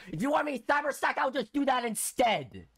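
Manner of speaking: loud, high-pitched exasperated shouting; angry retort. Real, not acted. Gamer defying a stage that demands cyber stacking, angry shout. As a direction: fire it back at full volume.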